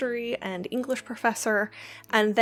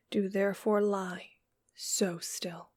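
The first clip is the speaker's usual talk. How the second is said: softer, hushed whisper through the lines; whispered recitation; 5 dB quieter than usual. Acted. Reading a poem to the dead, hushed whisper. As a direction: whisper it like a secret vow.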